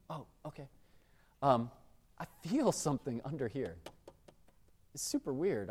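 put-on soft whispered old lady; old lady whispering. Old woman in a wheelchair whispering her order. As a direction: hush it like a frail old customer. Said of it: "she's whispering her".

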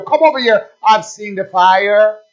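loud, hammed-up come-over-here call; mock loud invitation. Preacher mimicking a boastful church, loud mock invitation. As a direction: beckon loudly like a huckster. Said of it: "they don't have the fire over here".

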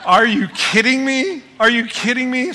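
loud, high disbelief; can't believe what they just said; outraged disbelief; incredulous. Real, not acted. Outraged at advice.

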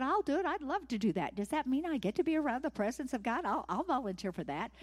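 loud, high and fast, bursting to help; can't wait to say yes; eager volunteer. Retold or imitated. Obed-Edom volunteering eagerly again.

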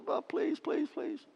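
tiny high, soft plea; mock begging. Preacher mocking the idea of God begging, high soft pleading. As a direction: mimic desperate begging, hushed and high-pitched. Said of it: "God doesn't beg and plead".

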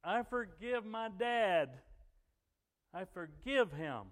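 loud, high, drawn-out declaration; retold full-voiced cry of forgiveness. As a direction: cry it out to heaven, slowly. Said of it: "she cried out".

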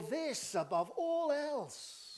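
high, slow, ringing emphasis; drawn-out, forceful proclamation; climactic declaration. Shown as a demonstration. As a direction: build "this above all else" to a climax.

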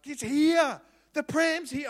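high, loud thrilled announcement; excited squeal. Retold or imitated. Wife ringing her husband about the pram, high and loud with excitement. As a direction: burst out with the good news. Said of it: "and she's like".

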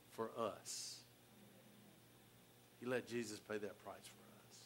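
low, soft, grave preaching; hushed solemnity. Real, not acted. Preacher telling congregation why God held back, hushed. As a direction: drop low and let it sink in. Solemn climax about God's sacrifice.

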